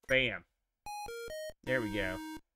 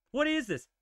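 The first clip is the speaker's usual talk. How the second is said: pitched-up What is this? with a falling end; indignant; 8 semitones higher, 3 dB quieter than usual. Real, not acted. Gamer complaining at the screen in disbelief.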